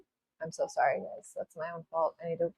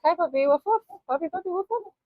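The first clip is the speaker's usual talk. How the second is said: cooing to the puppy; 13 semitones higher than usual. Real, not acted. Greeting the family puppy who wandered back, high cooing baby talk.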